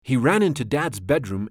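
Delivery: usual speaking voice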